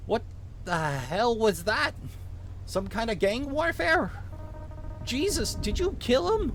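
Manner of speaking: put-on shocked Minnesotan officer questioning a kid; vaguely Minnesotan accent. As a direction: rise into high-pitched Minnesotan disbelief. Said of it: "give him a vaguely Minnesotan accent".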